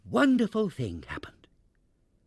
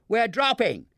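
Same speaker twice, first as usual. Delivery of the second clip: high, loud alarmed shout; 10 semitones higher, 5 dB louder than usual. A narrator's reading. Barnaby's father warning everyone as the balloon falls, loud shout. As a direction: raise the alarm. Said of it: "shouted Barnaby's father".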